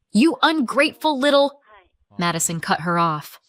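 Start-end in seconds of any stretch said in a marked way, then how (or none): 0.1-1.6 furious. Acted. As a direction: spit it at her.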